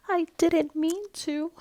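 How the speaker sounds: high, slow, near tears; boy apologizing to his grandmother; tearful whisper. A narrator's reading. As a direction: whisper the apology through a sob. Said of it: "he whispered".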